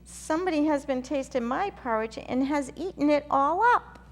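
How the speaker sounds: high, loud, squeaky little bear wail; rising to a shrill wailing cry; wee bear crying out. A narrator's reading. Baby bear wails that his porridge is gone, high and loud. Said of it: "the little small wee bear cried".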